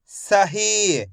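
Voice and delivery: सही with a long final ee; short स, then held ही in सही; stretched pronunciation. Shown as a demonstration. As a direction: blend सही carefully for learners. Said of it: "मैं इसका खींच के उच्चारण कर रहा हूँ".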